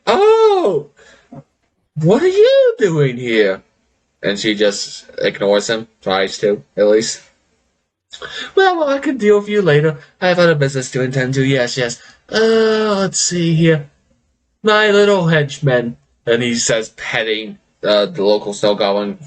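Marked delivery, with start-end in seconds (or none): 0.1-3.6 much higher, slow mock-sweet greeting; gleeful villain. GM voicing gleeful villain Leo. 8.5-16.0 higher, quick gloating lich; smug villain. Acted.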